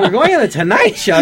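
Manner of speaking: high and loud, laughing with excitement; can't believe it, half laughing; giddy disbelief. Retold or imitated. Repeating the news to the director, high giddy disbelief. Stunned by Tonight Show news.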